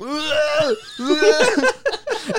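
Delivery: high-pitched burst of laughter. Real, not acted. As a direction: crack up laughing. Cracking up at story.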